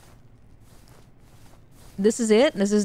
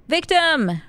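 high, excited cry; excited discovery; 7 semitones higher, 3 dB louder than usual. Real, not acted. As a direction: exclaim it on spotting your prey. Spots a victim in the game.

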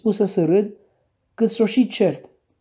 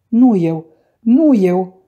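insistent, louder, lightly tearful denial; tearful protest; 7 dB louder, much slower than usual. A narrator's reading. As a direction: narrator lightly acts a sobbing girl. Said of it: "zise Cathy plângând cu hohote".

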